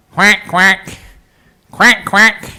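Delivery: amateur duck impression in short quacks; not very good duck. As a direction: imitate a duck, badly. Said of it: "That's not very good duck".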